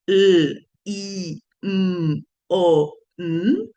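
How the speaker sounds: l, i, m, o, n each sounded alone with a pause; separate sounds. Shown as a demonstration. Teacher to students, blending sounds: l, i, m, o, n sounded apart. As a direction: stretch each letter l, i, m, o, n as an isolated phoneme. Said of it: "Unir implica que yo les digo".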